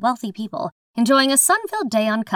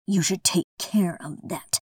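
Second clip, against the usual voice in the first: cold; 3 dB quieter than usual. Acted. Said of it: "The first voice is cold".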